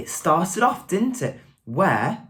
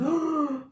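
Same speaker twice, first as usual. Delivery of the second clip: sharp, high storybook gasp; frightened gasp; 11 semitones higher, 3 dB quieter than usual. A narrator's reading. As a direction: suck in breath in mock fright. Fright at the tyrannosaurus.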